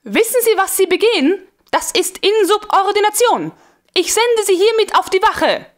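high, forceful furious princess; furious officer's dressing-down; angry rebuke. A narrator's reading. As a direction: stamp out the reprimand. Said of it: "im Zorne fort".

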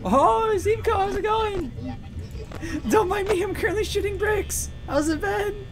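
silly high greeting. Pretend. Greets chatter in a put-on voice.

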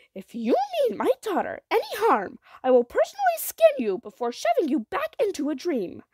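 high, loud, emphatic mother's warning; forceful, heavily stressed warning; stern threat. A narrator's reading. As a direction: lay down a fierce maternal threat.

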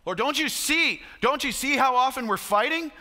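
high, urgent cry to the Lord; acted desperate questioning, pitched high; pleading prayer. Voices married people's desperate prayer.

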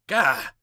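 sudden loud "gah"; startled yelp. Acted. Lawyer bursting in on strangers, startled loud yelp. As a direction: yelp as if caught off guard.

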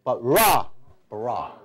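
drawn out syllable by syllable, slowly; spelling out each sound of Ra slowly; slow syllable demo. Shown as a demonstration. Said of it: "R加上Resh，R".

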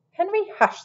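high-pitched mild telling-off; shushing. A narrator's reading. Tilly quieting Henry at the table, mildly scolding.

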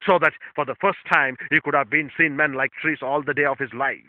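preaching. Acted. Pastor urging the congregation, rising preaching voice. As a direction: preach it out to the brethren.

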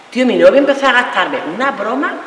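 ordinary talk, nothing marked

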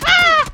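sharp high scream; pained scream. Real, not acted. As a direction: cry out when hit. Hit in gunfight.

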